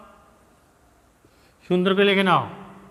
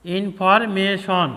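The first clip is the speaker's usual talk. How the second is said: slow; much slower than usual. Shown as a demonstration. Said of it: "ভেঙে ভেঙে বানান করতে হবে।".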